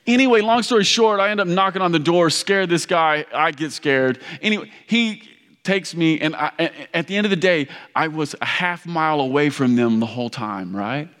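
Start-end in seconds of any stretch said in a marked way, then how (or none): none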